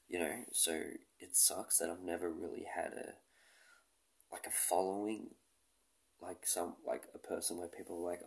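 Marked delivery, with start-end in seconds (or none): none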